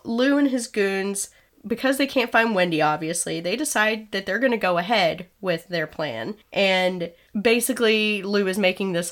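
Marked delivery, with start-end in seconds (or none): none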